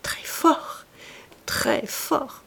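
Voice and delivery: breathy murmur close to her ear. Acted. The chevalier murmuring to Clorinde, close and hushed. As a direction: murmur it secretly, amused. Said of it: "Il murmurait".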